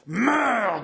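high, raging cry of hate; furious cry. Acted. François raising his dagger over his brother, furious high cry. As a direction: spit the word out in fury. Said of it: "gronda-t-il".

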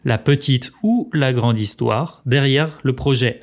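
usual speaking voice

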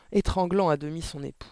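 ordinary delivery

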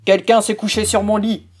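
the great big bear, gruff and put out; a big father bear grumbling; big bear's big voice. A narrator's reading. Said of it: "dit le grand gros ours de sa grosse voix".

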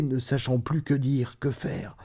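ordinary delivery

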